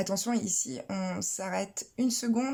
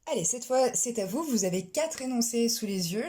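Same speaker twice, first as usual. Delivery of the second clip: usual speaking voice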